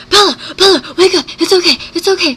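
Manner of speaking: put-on high, loud calming of a panicked girl; urgent father. Charlie wakes his screaming daughter, loud and urgent. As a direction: rouse her and soothe her at once.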